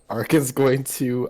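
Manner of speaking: usual speaking voice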